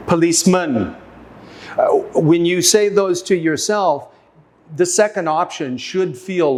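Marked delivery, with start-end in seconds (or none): 0.1-1.0 "Policeman" with a long, stressed final MAN; end-stressed "Policeman", puh-leece-MUN; stress on third syllable. Shown as a demonstration. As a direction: punch the last syllable of "Policeman". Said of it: "try each option".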